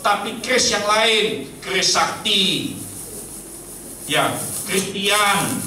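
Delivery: high, slow, emphatic pulpit warning; ringing, stretched-out sermon delivery; forceful preaching. Real, not acted. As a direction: proclaim each phrase with drawn-out force.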